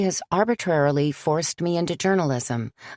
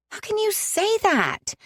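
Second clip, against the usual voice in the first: much higher, shrill outburst. Acted. [name] protesting to Father John in shrill disbelief. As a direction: voice cracking up high in disbelief. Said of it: "My own shrill voice surprised me".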